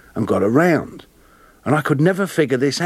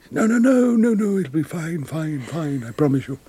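John Gielgud; 3 semitones higher than usual. Retold or imitated. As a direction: imitate an actor soothing away doubts, starting high and sinking. Acts out Gielgud reassuring him.

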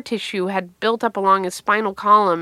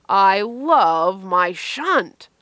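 mock adoration; much slower than usual. Brother's sarcastic refrain about his shunt, hammed-up adoration. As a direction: draw out every word in exaggerated devotion.

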